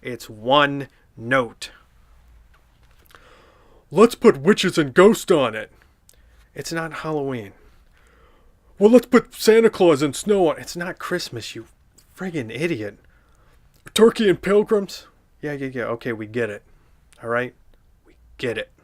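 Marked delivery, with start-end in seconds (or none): 3.9-5.7 Patrick. Acted. Mocking the show's one joke. 8.8-10.6 Patrick. Acted. Mocking the show's one joke. 13.9-15.1 Patrick. Acted. Mocking the show's one joke.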